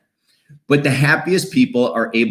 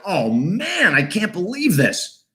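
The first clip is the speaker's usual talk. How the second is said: higher, slow dismayed sigh of disbelief; frustrated groan; 7 semitones higher, slower than usual. Retold or imitated. Traveller who missed the flight, high and slow in dismay. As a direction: let the letdown sink in.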